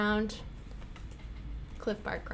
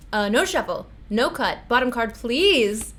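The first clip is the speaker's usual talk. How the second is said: normal talking voice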